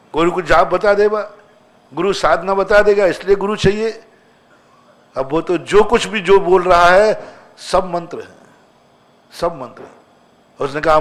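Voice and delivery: normal talking voice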